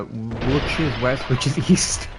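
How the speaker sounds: slow, mock-confused which is West; mock dumb. Joking about being an idiot, mock confused questioning. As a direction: play the idiot.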